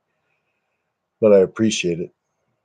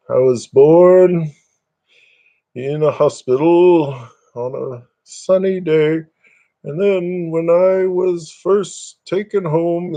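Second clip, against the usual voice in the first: mock documentary; 7 semitones higher, slower than usual. Mock-dramatic life story narration.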